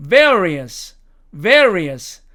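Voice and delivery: VARIOUS as "VEH-uh-ree-uhs"; British various. Shown as a demonstration. Teacher showing British pronunciation, VARIOUS with an uh glide. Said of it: "영국식 발음으로 가면 느림표가 어로".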